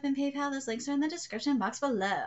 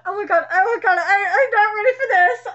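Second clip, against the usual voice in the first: nervous excited; 8 semitones higher, 12 dB louder than usual. Real, not acted. Nervous excitement before reading.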